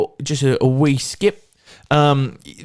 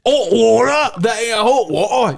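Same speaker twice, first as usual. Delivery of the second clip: high, put-on British accent; mock British twang on hot water; playful fake British accent; 7 semitones higher, slower than usual. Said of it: "as they would say in Britain".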